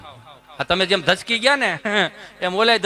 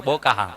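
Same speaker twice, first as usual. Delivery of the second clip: બોકાહા dropped low, savoured; relishing the word; 9 semitones lower, slower than usual. Shown as a demonstration. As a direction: roll બોકાહા out deep. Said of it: "બોલો તો જ મજા આવે". (બોકાહા નીચા સૂરમાં ચાખીને)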